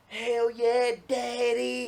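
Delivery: high, loud, whooping gamer yell; hammed-up battle scream. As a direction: scream it like you're charging into the room. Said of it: "I'll be just scream".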